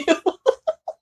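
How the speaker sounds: high amused laughter; laughing at oneself. Real, not acted. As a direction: burst into high giggles. Amused at songs she listened to.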